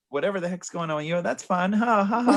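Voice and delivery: high, chirpy fake-friendly kid voice; hammed-up bright kid; mock cheerful kid. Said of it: "And the kids are like".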